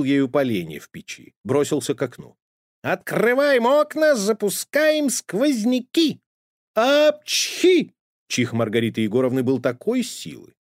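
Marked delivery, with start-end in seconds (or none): 2.8-6.2 very high, slow, stretched cheer; high stretched happy cat shout; joyful yell. A narrator's reading. Cat throws open windows. 6.7-7.9 huge high loud sneeze; booming cartoon sneeze; thunderous sneeze. A narrator's reading. Mighty sneeze.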